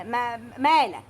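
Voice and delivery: usual speaking voice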